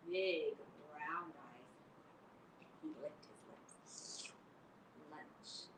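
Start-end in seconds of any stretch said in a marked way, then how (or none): 2.8-5.7 low slow breathy whisper; hungry whisper. A narrator's reading. Narrator voicing the hungry cat, sly whisper.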